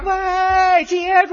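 high, loud call down the street; shouting after him. Retold or imitated. Girl shouts after the cart thief. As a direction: yell for him to catch. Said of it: "这姑娘就喊上了".

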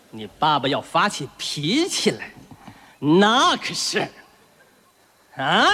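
low, very slow warning; drawn-out fatherly lead-in, prompting the answer; pompous stern father. Acted. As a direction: stretch it out and leave the threat hanging.

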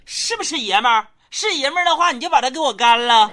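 high-pitched, bossy mother; acted motherly dare; mom's challenge. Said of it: "只听我老妈对老爸说一句".

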